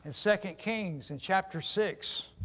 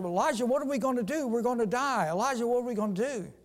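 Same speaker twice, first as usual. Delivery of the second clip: fast, higher frantic pleading; panicked cry; 4 semitones higher, much faster than usual. Retold or imitated. Servant begging Elijah for help against the army, fast and panicked. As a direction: babble it out in terror. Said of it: "the servant cried to Elijah".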